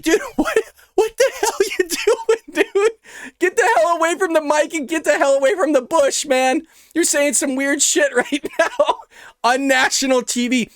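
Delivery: high, laughing mock scold. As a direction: yell at him through the giggles, pitched high. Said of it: "It's like".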